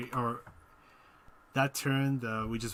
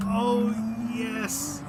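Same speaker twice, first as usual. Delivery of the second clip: excited cheer; 11 semitones higher than usual. Real, not acted. Celebrates a good lap.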